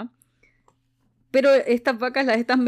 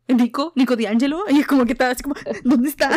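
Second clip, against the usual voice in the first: loud, urgent calls for Nico; frantic calling out; 2 semitones higher, 6 dB louder, faster than usual. Retold or imitated. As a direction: demand to know where Nico is. Percy frantic over Nico. (llamando a Nico, urgente y fuerte)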